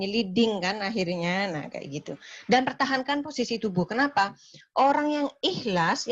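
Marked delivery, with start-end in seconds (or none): none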